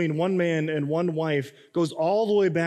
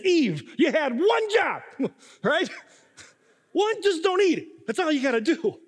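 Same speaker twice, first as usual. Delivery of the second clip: exasperated mock scolding; 12 semitones higher than usual. Husband in delivery room scolding Eve, exasperated. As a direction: scold her like she blew the simplest task. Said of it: "all I'm thinking is".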